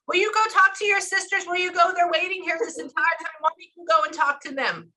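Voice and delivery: high, insistent mock nagging; exasperated prodding, pitched well up; imitated nagging. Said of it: "like that".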